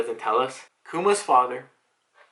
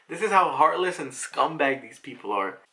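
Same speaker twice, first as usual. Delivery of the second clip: plain reading voice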